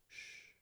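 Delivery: very soft drawn hush; shushing. Retold or imitated. Carlito silently stops Lalin talking, soft shush. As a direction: shush softly, no voice. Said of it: "but he goes".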